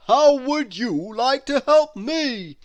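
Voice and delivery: high, loud, slow Santa voice; Santa's booming question. A narrator's reading. Santa asking the snowman for help: booming hearty voice. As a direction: ask with big hearty cheer. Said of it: "he asked the snowman".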